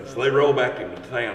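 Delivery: usual speaking voice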